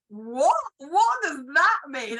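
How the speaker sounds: loud, high, drawn-out incredulous question; mock bafflement, stretching the words upward; retold baffled disbelief. Acts out co-writer's baffled reaction.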